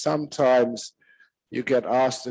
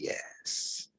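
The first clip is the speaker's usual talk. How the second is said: sweet soft yes; 13 dB quieter than usual. Retold or imitated. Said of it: "she smiles sweetly".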